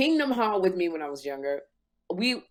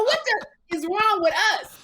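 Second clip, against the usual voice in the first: incredulous outcry; 13 semitones higher than usual. Retold or imitated. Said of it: "I go".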